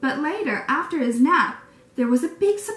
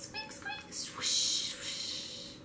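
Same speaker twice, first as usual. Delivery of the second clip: high tiny squeaks, then a whispered swoosh; bat squeaks and wind; 15 dB quieter, much slower than usual. Acted. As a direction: make the cave noises for the kids. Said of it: "bat squeaks and wind noises".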